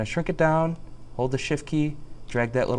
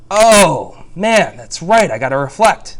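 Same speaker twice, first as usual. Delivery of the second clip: loud, high exclamation of sudden realizing; hammed-up outburst of remembering; mock realization; 4 semitones higher, 11 dB louder, slower than usual. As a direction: act out a big "it just hit me". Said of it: "That's a good way to remember".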